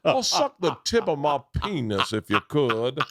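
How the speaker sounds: camp North Carolina gentleman; North Carolina accent. Acted.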